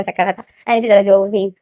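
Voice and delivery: ordinary delivery, nothing marked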